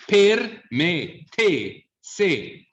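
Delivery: "Per me, te, se" with every E closed. Shown as a demonstration. Teacher listing examples, all Es closed in "Per me, te, se". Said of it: "all closed, yeah?".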